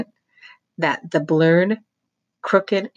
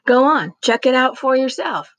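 put-on higher, eager boy's voice; Roy challenging; 9 semitones higher, 4 dB louder than usual. A narrator's reading. Roy urging the crowd to check the owl holes, higher. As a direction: dare them to go look.

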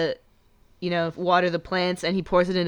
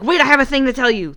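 eager interruption; 6 semitones higher, 6 dB louder, faster than usual. Retold or imitated. Mock interrupting a friend.

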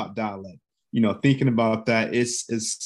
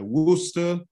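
Boston pronunciation of Worcester; 7 semitones higher than usual. Retold or imitated. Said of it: "they say, like".

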